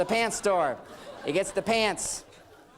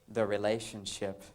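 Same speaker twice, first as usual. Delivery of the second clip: wife's solemn word; 10 semitones lower, 5 dB quieter, slower than usual. Retold or imitated. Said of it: "She goes".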